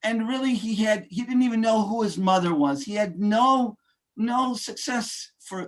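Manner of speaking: normal talking voice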